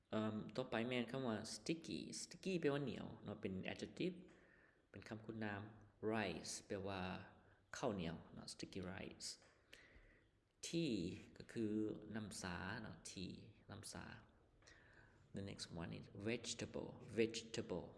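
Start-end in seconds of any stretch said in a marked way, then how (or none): none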